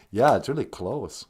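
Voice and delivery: ordinary talk, nothing marked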